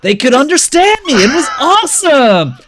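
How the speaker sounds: demonstrating a put-on American accent; American accent, climbing high on the punchlines. Shown as a demonstration. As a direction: switch into showy American. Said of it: "I spoke with the American accent".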